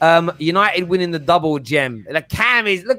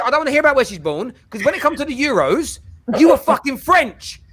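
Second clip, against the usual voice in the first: heated rant; 6 semitones higher than usual. Real, not acted. Heated argument over nationality.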